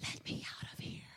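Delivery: soft unvoiced whisper. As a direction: breathe it like a voice trapped in a box. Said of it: "it whispers".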